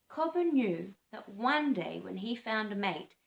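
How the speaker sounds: ordinary delivery, nothing marked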